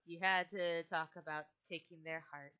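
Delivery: ordinary delivery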